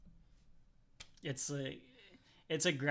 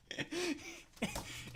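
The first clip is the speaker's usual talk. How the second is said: high startled squeal; a sudden high-pitched yelp; shrill shocked yelp; 5 dB quieter than usual. Pretend. Tasting bad candy, shocked.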